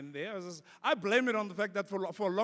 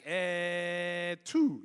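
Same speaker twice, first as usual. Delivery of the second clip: kid's mock eh English; 6 dB louder than usual. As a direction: parody the teacher's hesitation. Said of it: "it'd be like".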